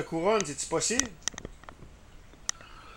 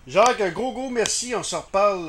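loud, higher thanks over a bad line; loud sign-off; 6 semitones higher, 9 dB louder than usual. Real, not acted. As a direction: raise the voice to be heard on the phone. Host signing off over bad line.

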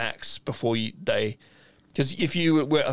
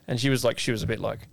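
ordinary talk

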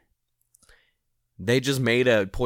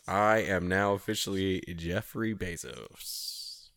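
low, slow, softer put-on voice; Jeff Bezos; 5 semitones lower, 5 dB quieter, slower than usual. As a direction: drop down and announce it slowly, in character. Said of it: "I gotta get his voice down".

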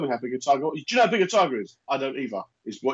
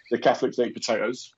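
usual speaking voice

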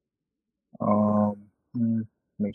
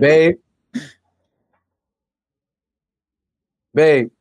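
calling out; 6 semitones higher, 4 dB louder than usual. Real, not acted. Calling partner in another room.

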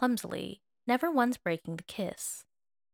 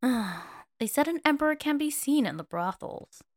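sulky, pouty; 6 semitones higher, 3 dB louder than usual. A narrator's reading. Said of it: "Voice sounded sulky and pouty".

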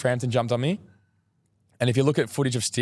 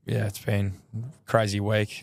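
ordinary delivery

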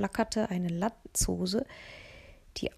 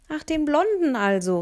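called out, higher and louder; calling after him; 7 semitones higher, 7 dB louder than usual. Acted. Girl calling teasingly after Mats, louder and higher. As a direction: tease him across the room. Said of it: "rief ich hinterher".

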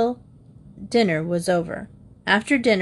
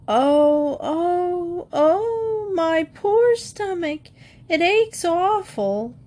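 much higher, slow whimpering moan; fake crying groan; 11 semitones higher, much slower than usual.

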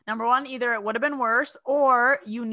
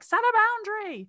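mock yell; 4 dB louder than usual. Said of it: "I'm just yelling all the time".

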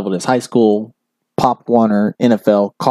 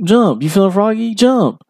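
imitating coach taunting; 9 semitones higher than usual. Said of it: "He, like, was like".

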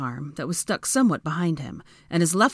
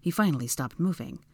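ordinary delivery

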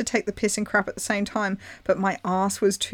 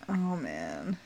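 breathy laugh; 6 semitones lower, 11 dB quieter than usual. Real, not acted. Laughing whisper at own story.

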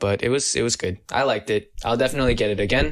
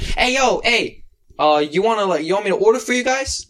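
high-pitched, raised-voice imitation of a stranger; calling from a car; 10 semitones higher, 3 dB louder than usual. Lady in a car offers to order for them, calling out. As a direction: call out high like a friendly driver offering help.

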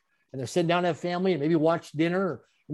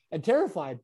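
ordinary talk, nothing marked

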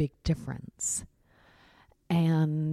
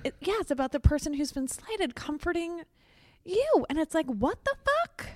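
indignant rise; 12 semitones higher than usual. Real, not acted. Indignant rant climbing up.

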